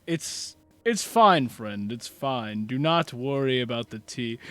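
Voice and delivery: measured, patient comfort; calm reassurance. Acted. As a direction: gently wave the worry away. Marquise soothes the wailing character.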